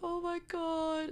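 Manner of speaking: high, slow, stretched-out oh my God; cringing oh my God. Real, not acted. Watching a cringey scene, a high drawn-out groan of disbelief. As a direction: drag it out in squirming disbelief.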